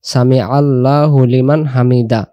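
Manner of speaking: হামিদা said with a plain open ending and no breathy h; wrong, no final h. Shown as a demonstration. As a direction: end হামিদা on a bare vowel with no h. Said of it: "হায়ের কোনো উচ্চারণ আসতেছে না".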